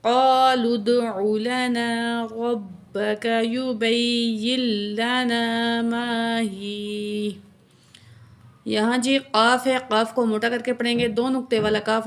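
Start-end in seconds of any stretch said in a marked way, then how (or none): 0.0-7.4 slow, melodic tajweed chanting, each sound stretched; Quran recitation. Shown as a demonstration. Teacher recites verse for her students, slow melodic chant. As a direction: chant the verse slowly and reverently, like a qari.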